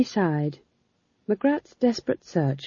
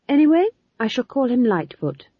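higher, louder defiant declaration; 10 semitones higher, 6 dB louder than usual. A narrator's reading. Young witch naming her tortoise against Granny, defiant. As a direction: stand your ground, chin up. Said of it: "her voice warm with defiance".

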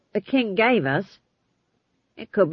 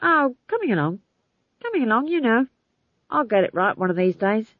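higher, evasive reassurance; 4 semitones higher than usual. A narrator's reading. Playwright asked how the play is going, evasive. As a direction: fend off the question in a raised voice.

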